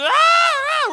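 high, very loud mock screaming; imitated child screams. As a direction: mimic a toddler howling in pain. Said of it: "he's just".

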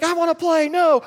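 put-on high, loud childish shout; shouting toddler. Toddler daughter refuses the bathroom, high loud childish shout. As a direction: yell it like a three-year-old refusing.

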